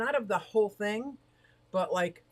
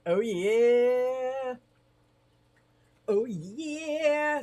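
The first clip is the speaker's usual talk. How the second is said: excited; 6 semitones higher, 5 dB louder, much slower than usual. Acted. Celebrating the name change.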